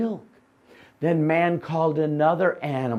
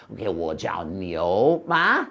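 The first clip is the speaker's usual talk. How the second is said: dopey donkey; 7 semitones lower than usual. Acted. Donkey guessing.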